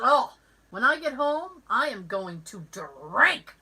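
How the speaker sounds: imitated weary vow, slowing right down at the end; mock-dramatic end-of-a-bad-day announcement; fed-up declaration. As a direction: spell out each final word emphatically. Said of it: "she was having a really bad day".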